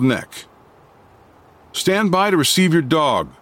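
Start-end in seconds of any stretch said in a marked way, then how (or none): 1.7-3.3 peremptory order. A narrator's reading. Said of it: "Scott's peremptory order".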